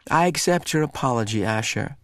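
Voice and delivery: usual speaking voice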